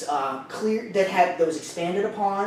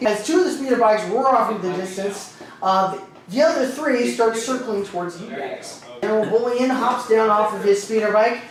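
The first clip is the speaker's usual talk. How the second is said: ordinary delivery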